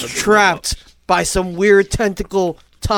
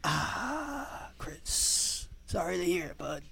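quiet; 15 dB quieter, much slower than usual. Retold or imitated. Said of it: "then I would be like".

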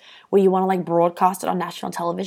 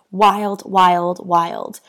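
emphatic wild; 6 dB louder, much slower than usual. Real, not acted. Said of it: "repeats wild in awe".

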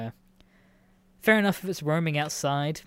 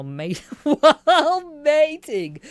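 laughing through words; 11 semitones higher, 9 dB louder, much slower than usual. Real, not acted. Cracks up reading article.

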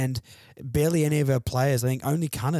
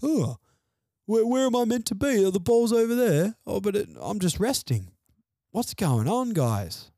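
high, lost, mock-confused voice of idle players; clueless footballers; 9 semitones higher than usual. Host mocking his team's players standing in open space, high confused voice. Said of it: "four guys just standing in an open space going".

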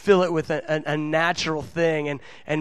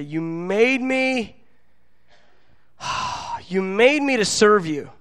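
mock cliche; 3 semitones higher, slower than usual. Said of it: "let me just give a cliche".

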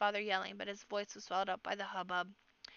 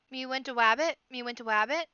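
put-on loud, higher baby-talk cry; excited toddler shout; 4 semitones higher, 9 dB louder than usual. A narrator's reading. Baby brother wriggling to get free, excited shout. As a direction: shout it like an excited little one, lilting up at the end. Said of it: "Pook shouted".

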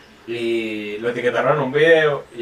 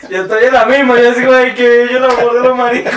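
alto y fuerte entre carcajadas; riendo; 8 semitones higher, 8 dB louder than usual. Real, not acted. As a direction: decirlo muerta de risa. (hablando entre carcajadas)

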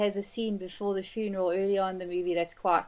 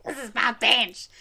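mock huffy; 2 semitones higher, 5 dB louder than usual. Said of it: "she's like".

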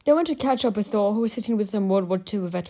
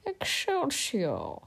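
very slow, soft, higher-pitched slur; drunken muttering; 6 semitones higher, 8 dB quieter, much slower than usual. A narrator's reading. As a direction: draw it out softly in a glassy-eyed daze. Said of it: "he was glassy-eyed and muttering".

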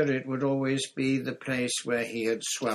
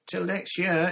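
higher, louder called farewell; shouting back; 8 semitones higher, 6 dB louder than usual. A narrator's reading. Captain Flint calls back to the departing fleet, shouted. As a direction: call it back across the water. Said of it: "he shouted back".

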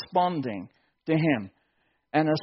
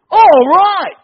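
very loud, high whoop of All right; ecstatic shout; 13 semitones higher, 16 dB louder than usual. Retold or imitated. Adam greeting Eve, ecstatic loud high whoop. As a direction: let it burst out of you. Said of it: "this is the Hebrew".

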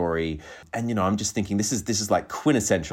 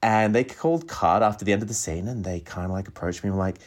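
ordinary delivery

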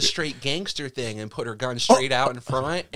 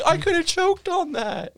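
laughing; 4 dB louder than usual. Retold or imitated. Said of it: "It was like".

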